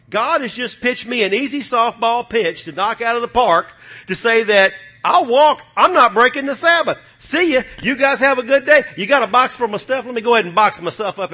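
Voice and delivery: loud, high and cheerful quitting the job; lightly acted worker walking out; a hint of a cheery employee leaving; mock quitting worker. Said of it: "acting out walking off the job".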